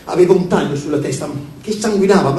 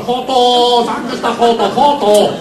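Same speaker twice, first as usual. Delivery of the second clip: raised, chanted cooing syllables; verso da piccione; 4 semitones higher, 3 dB louder, much slower than usual. A narrator's reading. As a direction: repeat it like a cooing bird. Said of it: "diceva solo". (cantilena da piccione)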